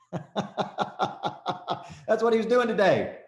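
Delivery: can't believe the size of it; laughing amazement. Real, not acted. Amazed at 300 million.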